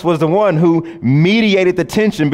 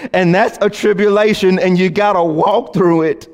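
higher and fuller, building intensity; rising refrain; 4 semitones higher than usual. Shown as a demonstration. Pastor building the tribulation refrain toward a climax. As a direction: drive the refrain home with force. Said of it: "repeated refrain, built up".

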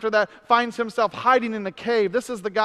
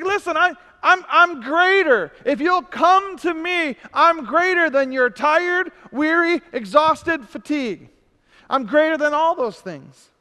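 higher and louder, declaring greatness; passionate proclamation; 7 semitones higher, 5 dB louder than usual. Acted. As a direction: proclaim it with rising fervour. Preacher voicing Jesus, rising.

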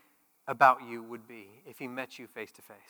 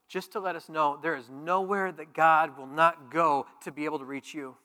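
ordinary talk, nothing marked

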